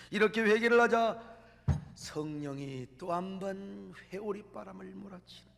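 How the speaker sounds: ordinary talk, nothing marked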